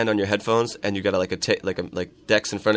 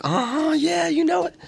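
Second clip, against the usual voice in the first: very high sassy agreement; mimicking the woman. The woman insisting she wants her gold, very high and sassy. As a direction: squeak out her smug yes way up high. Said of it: "she was like".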